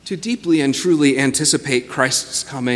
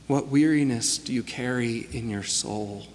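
guided meditation; 2 semitones lower, 7 dB quieter than usual. Acted. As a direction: guide them gently into stillness. Leads a guided reflection.

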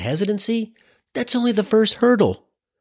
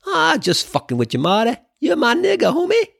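co-star joking; 8 semitones higher than usual. Acted.

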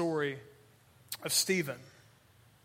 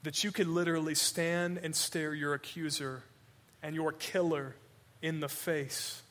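usual speaking voice

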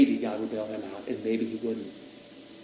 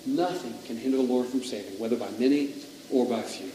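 softer, reflective repeat of scripture; hushed reflection; 5 dB quieter than usual. Real, not acted. Preacher dwelling on how true the verse is, hushed. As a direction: let the verse sink in quietly.

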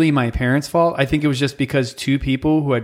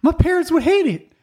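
giddy high mock yes; 14 semitones higher than usual. Comedian voicing a giddy barfly, high and eager. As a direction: chirp it like a thrilled rebel. Bar pickup acted reply.

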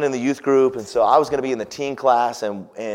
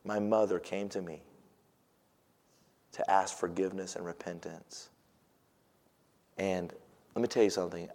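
sincere hush; 5 semitones lower, 10 dB quieter than usual. Real, not acted. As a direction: press the truth gently. Quiet sincere preaching point.

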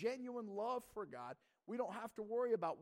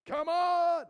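high, very loud comic yell; exasperated farmer's mock shout; mock scream; 12 semitones higher, 17 dB louder than usual. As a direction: scream impatiently at the crops, hammed up. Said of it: "screaming at the soybeans".

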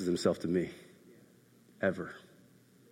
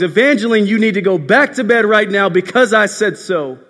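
loud, high angry parent's order; top-of-lungs yell; 11 semitones higher, 10 dB louder than usual. Retold or imitated. Dad orders daughter back to bed, yelling angrily. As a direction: re-enact yelling at a disobedient child. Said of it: "I yell at my daughter at the top of my lungs".

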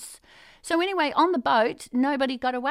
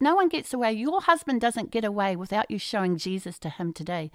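ordinary delivery

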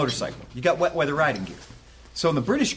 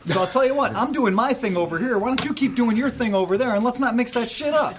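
higher, heated rant aimed at an imagined cop; mock rant at a cop; 7 semitones higher than usual. Ex-cop telling off a pushy officer, heated and high. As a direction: tell off the cop, worked up and high.

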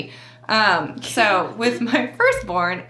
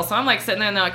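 ordinary delivery

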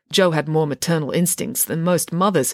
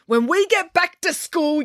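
high-pitched slowed yell; a teacher's raging yell; Mister Lang yells at the kids; 13 semitones higher than usual. A narrator's reading. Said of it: "yelled Mister Lang".

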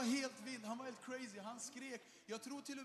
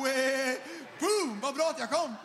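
loud, high, gleeful whooping; mock excited guys; 6 semitones higher, 19 dB louder, slower than usual. Preacher mimicking guys cheering at a holy kiss, excited. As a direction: ham up the thrilled guys.

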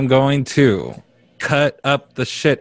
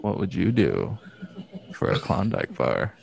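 ad slogan; 2 semitones lower, 9 dB quieter than usual. Retold or imitated. Imitating an ad slogan.

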